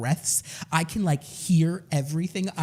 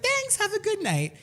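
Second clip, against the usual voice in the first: mock sweet goodbye.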